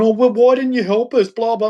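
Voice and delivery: high mock whine; pleading parody; mocking girl. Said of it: "you'll become".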